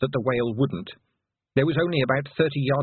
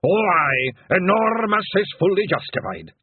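loud, high Scots bard, drawn out; slow declaiming Scottish pixie; William the pixie; 9 semitones higher, 5 dB louder than usual. A narrator's reading. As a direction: roll the r's and stretch it grandly. William answers in bardic Scots.

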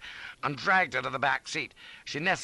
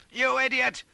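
high, loud insult; angry shout; 6 dB louder than usual. A narrator's reading. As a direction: shout in exasperation. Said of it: "I shouted".

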